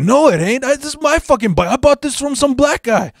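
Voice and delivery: put-on agitated, very high Cheech-style Mexican accent; Mexican accent impression. Thief challenges the bike's owner, defensive Mexican accent. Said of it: "that typical Cheech Mexican accent".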